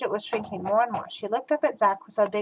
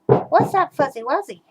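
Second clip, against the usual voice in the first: shouted; 5 semitones higher, 7 dB louder than usual. A narrator's reading. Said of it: "Bridget shouted".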